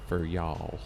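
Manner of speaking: low, lazy southern American y'all; southern American accent. Retold or imitated. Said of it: "I do love a southern American accent".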